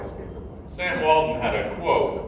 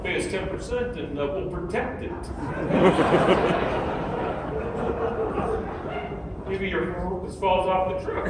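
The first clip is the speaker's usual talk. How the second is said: lightly voiced mobster, laughing through it; a hint of a mob threat, half giggling; terrible mob impression; 4 semitones higher than usual. Retelling the checkout pop-up as a mob racket, mock menacing. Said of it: "it's a terrible impression of the mob".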